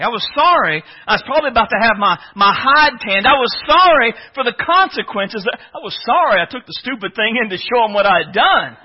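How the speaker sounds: ordinary talk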